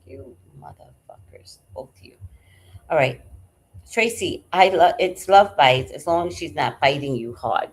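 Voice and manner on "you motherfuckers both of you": hissed under the breath; whispered cursing. Retold or imitated. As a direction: curse them in a hushed whisper. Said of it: "I was like".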